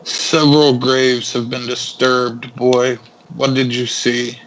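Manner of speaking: slow, deliberate in-character questioning; grave, unhurried inquisitor; stern cleric interrogating. Acted. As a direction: question the boy with measured authority. Cleric questions the nervous boy.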